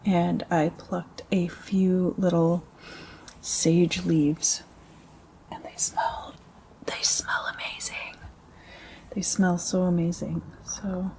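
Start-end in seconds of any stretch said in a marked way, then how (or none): 5.5-8.1 soft, voiceless whisper; hushed ASMR whisper. Real, not acted. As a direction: whisper it with relish. ASMR savouring, drops into whisper.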